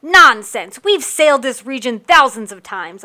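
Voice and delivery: loud scoffing retort; loud dismissal. Acted. Narrator scoffing at Aaliyah's warning, loud. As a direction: shout her down, sure of yourself.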